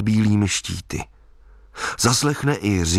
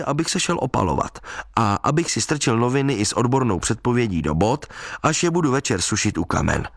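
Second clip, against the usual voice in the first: ordinary delivery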